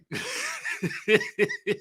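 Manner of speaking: high, wheezy cackling; real cackle. Real, not acted. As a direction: cackle helplessly to yourself. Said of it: "I just be cackling to myself".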